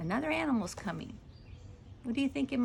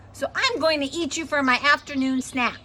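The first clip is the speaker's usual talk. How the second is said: loud, higher-pitched angry tiger; menacing tiger threat; 5 semitones higher, 10 dB louder than usual. A narrator's reading. Annoyed tiger means to eat the girl, loudly threatening. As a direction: threaten her at full volume.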